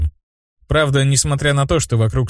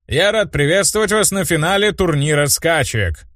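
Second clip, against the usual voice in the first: tournament host; 7 semitones higher than usual. A narrator's reading. Host opening the final, mild announcer voice. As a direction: welcome the crowd like a host. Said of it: "донесла его слова до всех зрителей".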